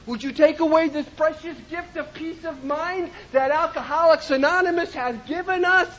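ordinary delivery

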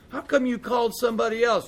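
high-pitched retold protest; indignant complaint. Caller challenging central office over being passed over, indignant. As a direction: demand an explanation, acting out the affront.

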